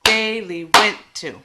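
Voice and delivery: "Daily went to" loud on DAY and WENT; trochaic rhythm. Shown as a demonstration. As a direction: beat out DAY-lee WENT to. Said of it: "getting kind of the musicality".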